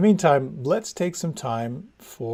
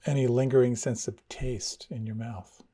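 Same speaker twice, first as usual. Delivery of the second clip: guided meditation. Acted. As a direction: ease them inward, unhurried. Leads a meditation.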